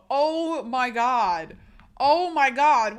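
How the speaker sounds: shocked. Real, not acted. Shocked reaction.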